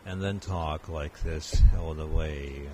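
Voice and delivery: very slow, even speech on one breath; teacher showing how to speak on one long breath; long breath delivery. Shown as a demonstration. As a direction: stretch it out all the way. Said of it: "たくさん息を吸って".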